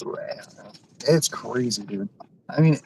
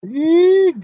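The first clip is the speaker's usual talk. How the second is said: startled squeak; 14 semitones higher, 7 dB louder than usual. Real, not acted. Squeezing groceries into small trunk.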